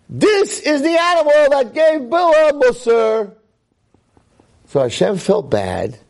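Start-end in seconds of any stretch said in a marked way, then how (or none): 0.1-3.3 mock gossip. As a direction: squeal it out like gossiping bystanders. People pointing at the donkey.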